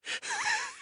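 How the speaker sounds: high wheezy giggle; a feverish wheezing laugh. A narrator's reading. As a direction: giggle breathlessly. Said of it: "Banks giggled harder, wheezing".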